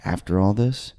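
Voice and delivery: low, hushed, confidential murmur; whispering cop. Retold or imitated. Arresting cop in crowded elevator whispers in his ear. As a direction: lean in and whisper it like a secret. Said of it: "whispers in my ear".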